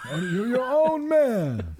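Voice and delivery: put-on high, slow old man voice; grumpy old man. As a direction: play the cranky neighbor, drawn out. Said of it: "Thanks, Pete".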